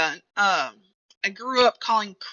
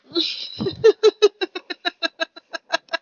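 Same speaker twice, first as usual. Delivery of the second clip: high, rattling run of laughter; long laugh; 8 semitones higher than usual. Real, not acted. Laughing at own pronunciation.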